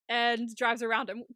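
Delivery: usual speaking voice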